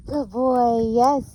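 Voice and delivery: high, loud praise burst; excited dog praise. Real, not acted. Trainer rewards her dog on the walk, high excited praise. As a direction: cheer the dog on brightly.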